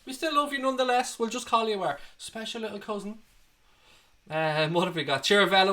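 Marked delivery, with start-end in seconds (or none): 0.0-3.2 high, softened teasing reassurance; mock consoling. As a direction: pretend to comfort him in a high little voice. Mock consoling a disowned family member.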